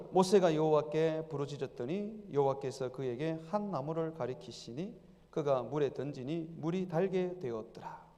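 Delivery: 느리고 낮은 성경 봉독; 한 구절씩 천천히 읽어 내려가기. A narrator's reading. (차분히 느리게 성경을 낭독)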